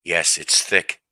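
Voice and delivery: normal talking voice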